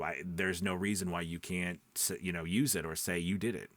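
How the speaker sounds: ordinary delivery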